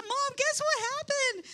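high, eager call to Mom; bursting to share the good news; excited telling. Retold or imitated. Grandmother tells the boy's mom, eager and high.

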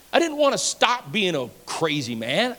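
ordinary delivery

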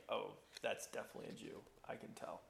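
imitating a private thought, nearly voiceless; hushed aside. Woman spots a stranger at the well, hushed to herself. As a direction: drop to a quick half-whisper, as if thinking aloud.

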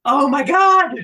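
gasping, high, loud re-enacted shock; acted-out shocked reaction, pitched high; horrified gasp. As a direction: gasp in horror, then cry it out loudly. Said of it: "both hands over my mouth, not breathing, just".

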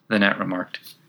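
plain reading voice

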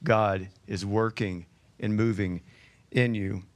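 soft, low read of James; quiet scripture read. Acted. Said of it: "James says".

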